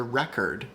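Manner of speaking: "record" with the first syllable stressed. Shown as a demonstration. Streamer asking viewers to listen, "record" stressed on first syllable. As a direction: let the second vowel of "record" relax to schwa. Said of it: "it's an uh of record".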